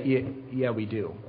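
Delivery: low, very soft reluctant agreement; sheepish admission. Acted. Crowd answering Peter's challenge, sheepish quiet admission. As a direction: concede it quietly, with a small stammer. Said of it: "everybody in the crowd would say".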